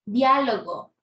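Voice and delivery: DIÁ-logo, first part loud, then falling; Diálogo with a strong opening syllable, falling away; stress on first A of Diálogo. Shown as a demonstration. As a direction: punch the DIA in Diálogo. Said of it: "Miren la diferencia".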